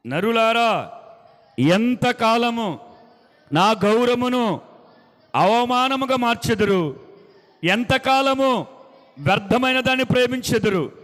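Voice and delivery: slow, loud, drawn-out divine lament; lamenting God. Acted. Preacher reading God's grieved words to people, slow and loud. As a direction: plead as a grieved God, stretching each word.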